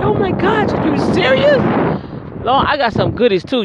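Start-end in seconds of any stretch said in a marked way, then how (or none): 0.0-1.6 very high squealing oh my God; thrilled disbelief. Retold or imitated. Hearing she won a prize, high excited squeal. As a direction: squeal with delight at the prize news. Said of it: "I said".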